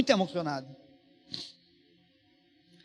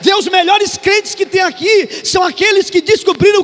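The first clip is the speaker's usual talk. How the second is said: high, loud preacher's shout; impassioned pulpit cry, sustained at top volume; full-force preaching; 11 semitones higher, 11 dB louder than usual. Real, not acted. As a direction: drive it home to the whole congregation.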